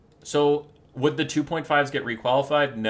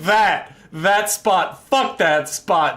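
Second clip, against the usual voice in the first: loud, emphatic mock command; mock tank commander; 5 semitones higher, 8 dB louder, much slower than usual. Tank driver picking a random target, mock commander. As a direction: hit each phrase hard, playfully decisive. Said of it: "He's like".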